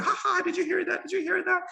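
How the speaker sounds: high-pitched mock drunken laugh and questions; drunken revellers. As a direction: laugh and crow like an amused drunk. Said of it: "they're drunk ... they just exaggerate the noise".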